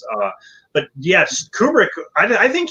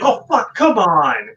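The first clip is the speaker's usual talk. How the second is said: higher, louder exasperation; mock frustrated outburst; 3 semitones higher, 4 dB louder, slower than usual. The director scolding the late actress, mock exasperated outburst. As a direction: blurt it like a fed-up director. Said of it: "he's like".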